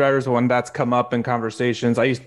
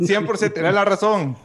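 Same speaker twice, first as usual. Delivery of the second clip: higher, eager empty affirmations; mock agreement in Spanish; 6 semitones higher than usual. Replying to chatty cab drivers, agreeing along in Spanish. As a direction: humor them along in Spanish. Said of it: "The same stuff you're saying, but in Spanish".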